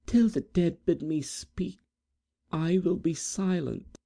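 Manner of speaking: high, fragile vow; gentle maiden. Acted. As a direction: Edith vowing to her rival. Edith's high character voice.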